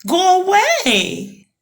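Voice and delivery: high, slowing monkey voice; a high monkey proposing a plan. A narrator's reading. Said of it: "the monkey had a new idea".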